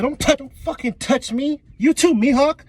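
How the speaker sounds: high, indignant mock defiance; hammed-up defiant protest; standing up for himself. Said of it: "I wish Buggy would stand up for himself".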